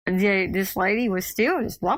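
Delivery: ordinary talk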